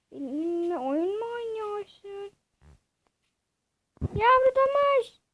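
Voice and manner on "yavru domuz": loud call to the piglet. Acted. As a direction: call out across the field. Calling the piglet.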